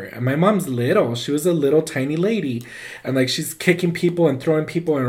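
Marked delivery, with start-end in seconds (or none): none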